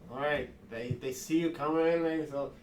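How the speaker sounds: usual speaking voice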